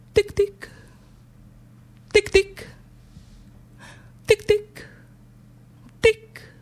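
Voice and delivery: tic tic acuti e staccati, molto più in alto; ticchettio imitato. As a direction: imita il ticchettio degli occhiali neri. Imita il ticchettio degli occhiali. (ticchettio acuto imitato con la voce)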